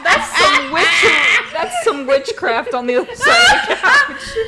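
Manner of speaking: high, loud, shrieking laughter; can't stop cracking up; hysterical laughing. Real, not acted. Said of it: "Not the fucking laugh".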